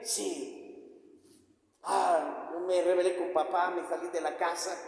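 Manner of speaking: low, soft, broken admission of sin; quiet confession. Acted. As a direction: confess it under your breath, ashamed. Voicing a repentant sinner.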